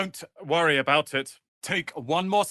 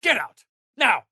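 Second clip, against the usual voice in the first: high, loud furious order; sharp angry dismissal; 9 semitones higher, 4 dB louder than usual. Acted. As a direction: throw them out, full force. Throwing someone out.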